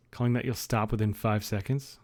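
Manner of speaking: normal talking voice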